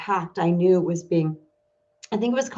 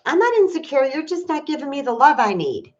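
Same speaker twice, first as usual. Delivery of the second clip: defensive retort; 7 semitones higher than usual. Retold or imitated. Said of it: "and you're like".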